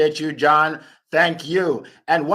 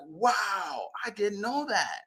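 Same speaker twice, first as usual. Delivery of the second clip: hushed, higher-pitched wonder; amazed reaction; 4 semitones higher, 8 dB quieter, slower than usual. Acted. Host imitates an amazed workshop attendee, hushed wonder. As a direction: play an attendee softly marvelling.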